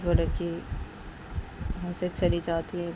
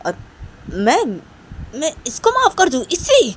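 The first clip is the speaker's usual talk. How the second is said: fuming rant; 6 dB louder than usual. Acted. Nisha in the car raging about the girl, furious. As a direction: spit out the outrage.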